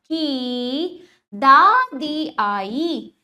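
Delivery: very slow, stretched की दादी आई; word-by-word classroom model of दादी आई; slow demonstration reading. Shown as a demonstration. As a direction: hold each long vowel in दादी आई. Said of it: "अब वाक्यों का उच्चारण करते हैं".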